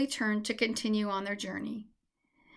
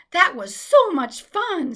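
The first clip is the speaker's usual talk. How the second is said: high, louder, slow and delighted; laughing; 7 semitones higher, 9 dB louder, slower than usual. A narrator's reading. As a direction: bubble over with fun. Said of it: "Sammy laughed".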